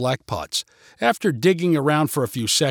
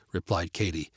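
usual speaking voice

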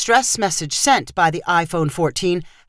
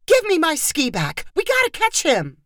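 urgent demand; 9 semitones higher than usual. A narrator's reading. Urgent chase after the thief.

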